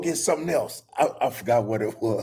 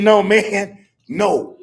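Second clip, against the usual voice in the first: louder and higher, no man no; exasperated shout; 7 semitones higher, 8 dB louder, slower than usual. Retold or imitated. Grandfather yelling at grandson punching the wall, loud and exasperated. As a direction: yell the correction, half laughing.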